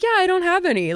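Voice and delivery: high breezy offhand voice; airy high casual admission. Pretend. Friend shrugging off having no toothbrush, high and breezy. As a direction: toss it off lightly, no big deal.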